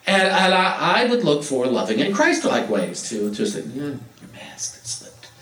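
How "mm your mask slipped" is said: soft, slow whispered hint; nice whisper. Retold or imitated. Telling a stranger their mask slipped, in a kind whisper. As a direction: whisper it kindly. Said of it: "I'd say it nicely".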